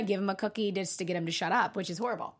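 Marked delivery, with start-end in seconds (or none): none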